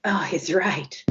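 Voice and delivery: stunned whisper to herself; whispered realization. Retold or imitated. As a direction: hush the sudden realization. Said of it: "And I realized".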